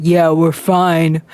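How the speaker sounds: slow, low casual reply; imitated tall man. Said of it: "this man who is really tall".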